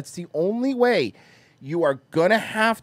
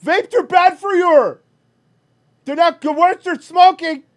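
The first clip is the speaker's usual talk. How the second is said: much higher and louder, a mocking nerd voice; mocking dumb voice; 12 semitones higher, 9 dB louder than usual. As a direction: squeak it out like a know-it-all kid, slowing at the end. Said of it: "instead you go".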